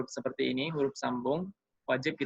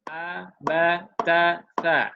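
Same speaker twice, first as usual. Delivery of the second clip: each letter on one steady beat, a bit louder; even beat recitation; 2 semitones higher, 5 dB louder, much slower than usual. Shown as a demonstration. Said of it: "ketukan daripada seperti ini". (dibaca rata satu ketuk tiap huruf)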